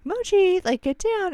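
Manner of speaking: high-pitched scolding call to a pet; calling off dog. Retold or imitated. Owner calling Emoji off the other dog, high-pitched scolding.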